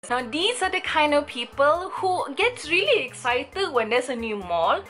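ordinary delivery

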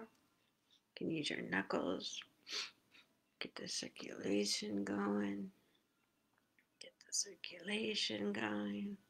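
hushed instructions; soft whisper. Real, not acted. Teacher whispers massage steps to the class. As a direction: keep it to a gentle whisper.

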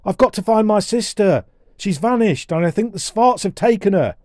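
put-on high, loud, anxious boy; worried boy crying out; urgent cry. A narrator's reading. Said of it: "cried Colin".

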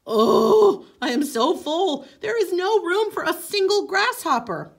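high, loud moaning complaint. Acted. Coyote moaning to the Great Spirit, too full. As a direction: groan it out, stuffed full. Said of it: "moaned Coyote".

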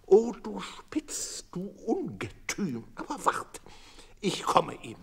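high, shrill-edged threat to the dog; a lightly voiced old widow scolding her dog. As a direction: wag a finger and threaten, voice way up.

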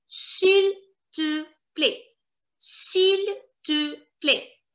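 "S'il te plaît" slowly, in three separate parts; syllable-by-syllable French. Shown as a demonstration. Teacher showing informal French please, "S'il te plaît" slowly word by word. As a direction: model "S'il te plaît" for learners, each word held apart. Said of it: "That is s'il te plaît".

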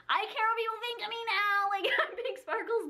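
high-pitched mock outburst; worked up. Telling viewers what she said in the lobby, worked up. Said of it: "so worked up about this".

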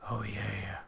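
soft, breathy whispered repeat of "Oh, yeah". Real, not acted. Savoring a find in the mine, whispered. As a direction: murmur "Oh, yeah" under the breath.

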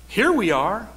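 mocking rise on here; sarcastic. Shown as a demonstration. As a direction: say it with a sarcastic lift. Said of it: "More sarcasm".

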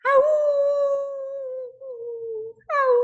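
mild howl; a short dog cry. Acted. Lucy crying in her new bed, short howls. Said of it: "Lucy cried".

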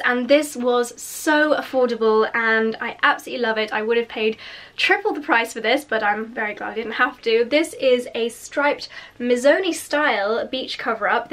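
ordinary talk

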